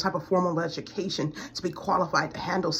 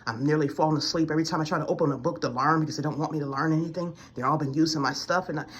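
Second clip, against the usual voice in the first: ordinary talk, nothing marked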